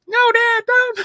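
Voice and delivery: squeaky high child begging, hammed-up; mock pleading. Acted kid pleading with dad.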